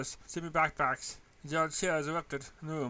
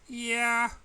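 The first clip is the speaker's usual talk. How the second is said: wary; 9 semitones higher, 7 dB louder than usual. A narrator's reading. Wary reply.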